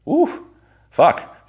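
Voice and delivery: sudden high yelp of dismay; a high, loud "ooh" that turns to a curse; high startled ooh, then a curse; mock realization. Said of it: "realizing the monster act failed".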